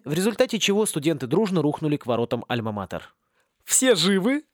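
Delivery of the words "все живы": a touch of bright cheer; cheerful question. Acted. Teacher cheerfully asking the groaning crowd. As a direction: ask gleefully if all survived. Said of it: "радостно спросила волшебница".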